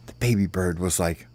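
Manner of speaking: usual speaking voice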